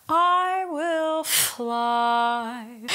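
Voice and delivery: the F of "fly" hissed long and hard, sung slow; sung line with a heavy F on "fly"; emphasized F. Shown as a demonstration. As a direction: overdo the F of "fly". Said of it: "emphasize the f of fly".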